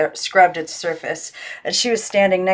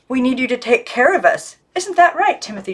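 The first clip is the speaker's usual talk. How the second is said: higher pitched doll voice, pleading; the girl speaking for her doll, lifted high; Miss Christabel voice; 6 semitones higher than usual. A narrator's reading. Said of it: "she said for Miss Christabel".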